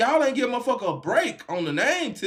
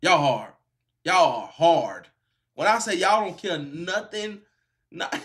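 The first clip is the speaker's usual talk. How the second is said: usual speaking voice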